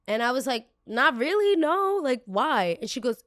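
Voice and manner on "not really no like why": high, puzzled pushback; baffled denial. Retold or imitated. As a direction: answer high and louder, questioning why. Retold baffled reply to scolding lady.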